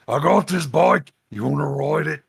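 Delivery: hammed-up orc calling to kids. Orc offers kids a ride on his bike, hammed-up orc voice. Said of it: "here's the orc boys".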